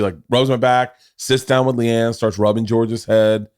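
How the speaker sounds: normal talking voice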